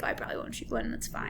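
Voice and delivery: breathy whisper to herself. Retold or imitated. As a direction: say it under your breath, resigned. Quietly resigned in the blind.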